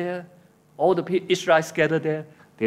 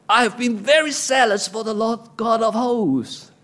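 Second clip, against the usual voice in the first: high-pitched, insistent self-defence; grumpy complaint; 7 semitones higher than usual. Acted. Preacher as Elijah defending himself to God, grumpy protest. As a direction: protest your zeal as an aggrieved prophet. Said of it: "I speak for him like that".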